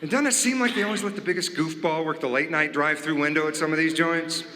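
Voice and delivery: ordinary talk, nothing marked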